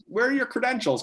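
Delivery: suspicious question. Retold or imitated. Reporter questions them.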